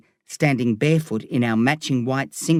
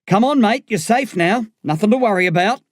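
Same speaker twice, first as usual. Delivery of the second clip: raised, higher voice of a paramedic; rally the patient with a loud, high voice; loud reassurance; 8 semitones higher, 4 dB louder than usual. A narrator's reading. Officer urging on the patient.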